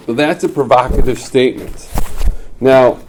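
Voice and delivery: emphatic lecture voice; loud emphasis. Real, not acted. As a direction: hammer the key term. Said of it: "teacher emphasizing key point".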